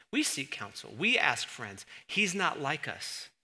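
ordinary delivery

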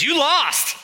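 high, loud mock gloating; taunting sports fan. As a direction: rub it in to the losing fans. Acted fan taunting losing team's fans.

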